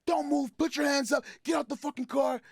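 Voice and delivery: high, barked police commands; police orders. Retold or imitated. Police shouting at the men in the car, barked orders. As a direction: bark orders at a suspect.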